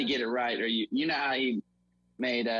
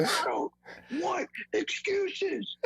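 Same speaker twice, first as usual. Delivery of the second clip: very high, slow strained scream; mock screaming coach; much slower than usual. Coach rejects player's excuse in film room, mock high screaming. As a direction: drag out the rebuff in a hammed-up scream. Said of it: "they start screaming".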